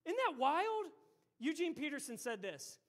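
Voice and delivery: ordinary talk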